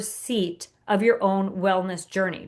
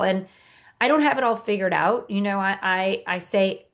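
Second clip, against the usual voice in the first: ordinary talk, nothing marked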